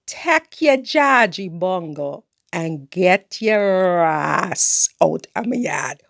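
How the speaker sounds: slow Creole telling-off. Retold or imitated. Telling Raphael off in slow Caribbean Creole. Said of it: "tell you again in Creole".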